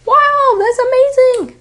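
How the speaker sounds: high, bright excited exclamation; excited wow. Acted. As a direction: gush "Wow, that's amazing" way up high.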